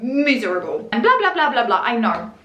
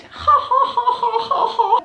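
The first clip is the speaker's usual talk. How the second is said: high, spelled-out ha-ha laugh; staged high cackle; read-out fake laughter; high mock laugh; 10 semitones higher, faster than usual. Acting out laughter in a comment.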